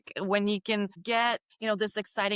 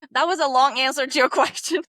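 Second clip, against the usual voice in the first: laughing; 7 semitones higher, 7 dB louder, faster than usual. Real, not acted. Laughs at her long answer.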